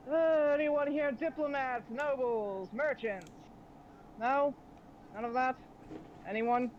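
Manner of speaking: lightly voiced captain surveying prisoners; a hint of a boss questioning captives; pirate leader. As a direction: call out the list, pausing for takers. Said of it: "he's looking over the rest of you".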